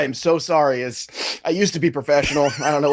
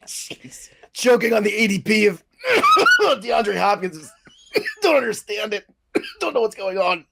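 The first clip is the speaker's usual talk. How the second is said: breaking into helpless laughter mid-sentence; can't stop laughing, words squeezed out; real giggle fit choking the words; 5 semitones higher than usual. Real, not acted. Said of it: "I had a little choked up moment".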